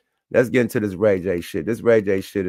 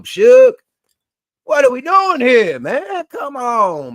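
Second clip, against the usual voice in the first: louder, higher exasperated yell; frustrated outburst; 13 semitones higher, 8 dB louder, slower than usual. Real, not acted. Calling out [name] and [name], loud and exasperated. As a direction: throw your hands up at them.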